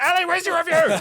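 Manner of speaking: high, loud nagging call; mock call. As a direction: shout the demand down the phone, teasing. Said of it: "I'll be like".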